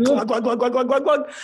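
mock gulping glug glug; glug sounds. Dad downing wine after the news, gulping sounds. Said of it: "he was just like".

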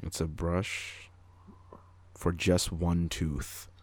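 drawn out, bone-dry delivery; deadpan. Pretend. Joke answer to a pharmacy customer, slow and deadpan.

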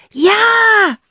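high, loud shouted yes; yell. Acted. Cassie suddenly gets it and shouts in the gallery. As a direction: yell it out in triumph. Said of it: "brüllt sie".

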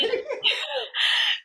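bright burst of laughter, falling away; high giggle that drops off. Real, not acted. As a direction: laugh out at the story. Laughing at her own story.

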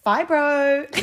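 high, loud mock mum; mum's voice. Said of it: "I can hear her voice in my head".

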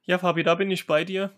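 ordinary delivery

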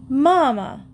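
put-on a girl startled that her mother knows; surprised cry. A narrator's reading. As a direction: blurt it out, caught off guard. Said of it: "cried Emily".

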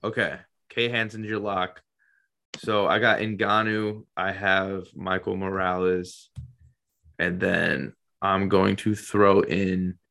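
usual speaking voice

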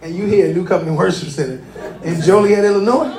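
amused questions broken by real laughter; laughing in amazed disbelief; laughing while asking. Real, not acted. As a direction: chuckle through the question in disbelief. Pastor laughing at guest's long trip.

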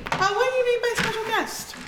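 high-pitched, questioning surprise; puzzled surprise. Acted. Woman asking the guard about special guest, puzzled surprise. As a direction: ask it high, suddenly curious.

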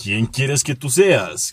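slow, theatrical question; telenovela reply. Acted. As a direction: answer with hammed-up gravity, taking your time. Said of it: "salió él y me dijo".

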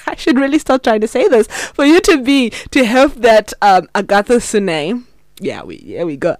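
ordinary talk, nothing marked

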